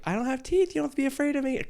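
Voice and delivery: high, gentle mock reassurance; sweet harmless voice. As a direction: coax it like a timid pet. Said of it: "being like".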